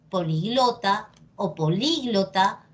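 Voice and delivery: poliGLOta, then poLÍglota; two accepted stresses. Shown as a demonstration. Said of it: "depende de ti cómo lo quieras decir".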